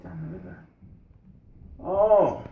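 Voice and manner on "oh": amused grandfather. Acted. Grandfather reacts with a laugh.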